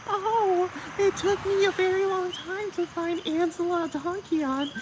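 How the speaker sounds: high, mocking falsetto of Serge; high-pitched mimicry of an interview answer; mocking imitation. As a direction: parody his voice way up high and prissy. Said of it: "And you're telling me".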